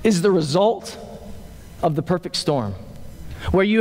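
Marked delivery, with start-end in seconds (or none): none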